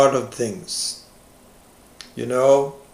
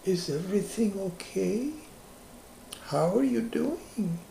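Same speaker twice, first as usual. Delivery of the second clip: careful concern; 6 semitones higher, 7 dB quieter than usual. Retold or imitated. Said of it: "carefully approach you and say".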